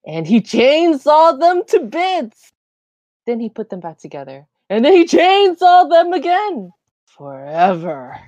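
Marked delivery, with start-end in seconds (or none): 0.1-2.5 slow, loud and high with relish; gleeful gory glee. Acted. 4.7-8.2 gleeful gory glee. Acted.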